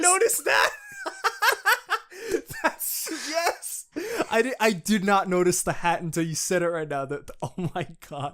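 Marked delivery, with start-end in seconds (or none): 0.8-2.6 high laugh. Real, not acted. Laughing at the hat.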